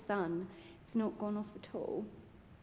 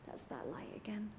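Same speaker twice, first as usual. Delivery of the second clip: whispering; 4 semitones lower, 13 dB quieter than usual. Real, not acted. Ghost hunt, notices light.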